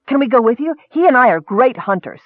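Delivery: high, eager pleading to come along; excited kender. A narrator's reading. Kender asking to join the elf's quest, excited. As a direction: pitch yourself keenly for the adventure.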